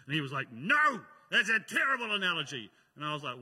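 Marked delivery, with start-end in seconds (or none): 0.5-2.7 angry lecturer. Retold or imitated. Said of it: "the Bible college lecturer got angry at them".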